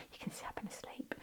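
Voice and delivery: hushed, quick whisper to herself; whispered aside. Real, not acted. Reader to viewers, admitting she's sleepy, whispered aside.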